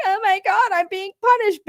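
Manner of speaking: hammed-up fake sob voice; mock crying. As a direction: imitate a put-on crybaby. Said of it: "sitting there crying like".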